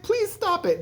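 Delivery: mock frightened girl's squeak; pleading little girl. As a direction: beg them to stop in a high kid voice. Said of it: "she's like".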